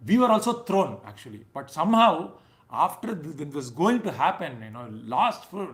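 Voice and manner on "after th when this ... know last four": ordinary delivery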